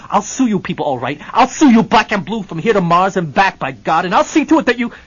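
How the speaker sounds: loud, high blustering threats; ranting to his lawyer; furious Hennessy. Acted. Hennessy bares chest, threatens suit.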